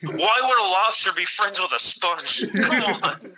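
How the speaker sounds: hammed-up incredulous complaint; mock exasperation. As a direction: question it like an indignant fan. Mock heckling a cartoon's plot.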